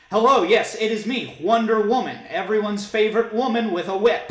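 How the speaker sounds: hammed-up heroine introduction in an accent; Wonder Woman. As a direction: proclaim yourself grandly in a foreign-tinged accent. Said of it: "she kinda sounds like a British person".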